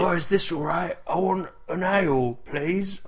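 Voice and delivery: slow, befuddled character voice; confused dimwit ordering a drink; weird confused dock worker. Acted. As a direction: play a dim, smoked-out dock worker. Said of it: "a weird dock worker who's smoked a lot".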